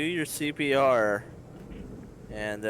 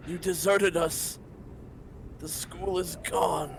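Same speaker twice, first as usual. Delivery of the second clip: higher, reproachful dead-master voice; scolding master; 7 semitones higher than usual. Acted. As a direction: berate your failed student. Master's head scolds Rillian.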